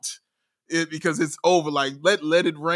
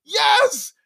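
much higher, shouting with joy; excited shouting; 12 semitones higher than usual. Real, not acted. Bulls fan celebrating a real point guard, excited shout. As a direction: yell it like a thrilled fan who can't believe it. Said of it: "this is why I'm so excited when I talk like this".

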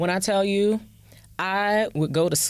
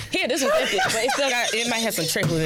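laughing; 4 semitones higher, faster than usual. Real, not acted. Laughing while joking about bottle.

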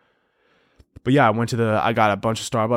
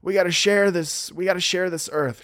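higher, preachy mimic; mock a preachy cyclist; higher mocking hippie plea; 7 semitones higher than usual. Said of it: "they're like".

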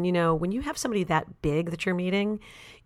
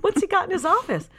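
nosy snooping; 11 semitones higher than usual. Retold or imitated. Snooping in the boss's bathroom.

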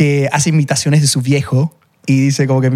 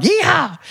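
high whooping cowboy "yeehaw"; a playful Southern cowboy call. Pretend. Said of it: "muy sureña".

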